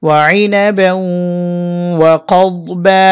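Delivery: slow chanted recitation; slow tajweed chant of ওয়াইনা ব্যাউ ওয়া কদ বা; recited together. Shown as a demonstration. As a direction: chant the phrase drawn out. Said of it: "একসাথে বলে দিচ্ছি".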